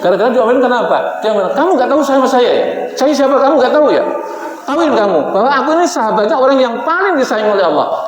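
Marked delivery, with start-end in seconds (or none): 1.5-8.0 Umar scolding. Retold or imitated. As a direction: puff up and scold the angel. Umar scolding, retold again.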